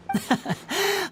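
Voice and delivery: a short amused chuckle; light laugh. Real, not acted. Magician amused that Paimon thinks she could do it, light laugh.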